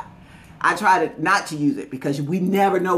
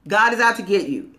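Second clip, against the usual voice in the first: ordinary talk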